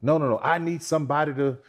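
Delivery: high, loud mocking voice of a picky woman; mock demanding woman. Mocking a woman with a long list of demands. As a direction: imitate a woman listing demands, laughing.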